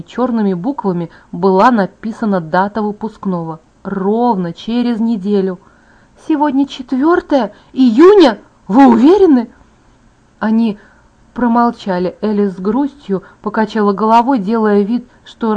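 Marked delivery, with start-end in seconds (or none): 6.3-9.5 high, loud, incredulous questioning; shocked disbelief. A narrator's reading. Bella learns graduation is a week away, shocked. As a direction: demand confirmation in alarm.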